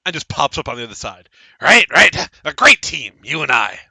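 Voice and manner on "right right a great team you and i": loud, pushy halfling agreement; halfling popping up to insist; eager halfling. Acted. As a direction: hammer it home, loud and eager. Halfling repeats pitch loudly.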